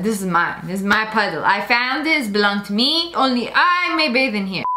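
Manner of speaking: lightly voiced possessive pup, pitched low; territorial dog. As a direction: claim the puddle like a grumpy guard dog. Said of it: "he's very territorial over it".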